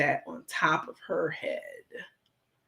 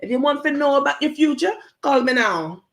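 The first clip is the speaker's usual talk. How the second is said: high, fast mock Jamaican accent; a hammed-up Jamaican psychic pitch in a fake Jamaican accent; 8 semitones higher, 4 dB louder than usual. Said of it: "she had the fake Jamaican accent on".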